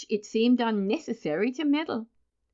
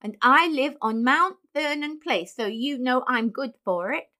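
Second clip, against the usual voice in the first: higher, put-on boyish voice; indignant retort; 4 semitones higher than usual. A narrator's reading. Benjamin giving the clerk his address, indignantly. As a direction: insist on being taken seriously. Angry boy's retort continues.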